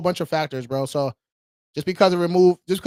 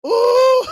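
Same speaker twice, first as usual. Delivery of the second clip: high, loud hoot breaking into laughter; whoop, then laugh in a long high trail; excited whoop and laugh; 3 dB louder than usual. Real, not acted. Host celebrating a twenty K Navy Fed win, high whoop into laughter.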